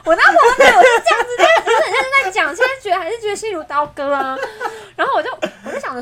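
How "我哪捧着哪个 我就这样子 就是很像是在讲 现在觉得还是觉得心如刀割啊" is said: high, loud, laughing retort; laughing protest. Real, not acted. Friend teases her mid-story, laughing protest. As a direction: protest through laughter, pitched way up.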